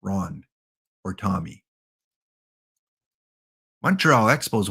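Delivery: ordinary delivery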